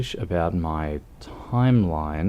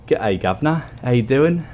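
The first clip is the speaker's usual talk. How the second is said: lightly put-on British accent, pitched up; attempted British accent; 5 semitones higher, 5 dB louder than usual. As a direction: have a rough go at a British accent. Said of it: "don't know how to do a British accent".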